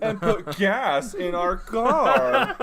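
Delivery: mock American. Mocking Americans game.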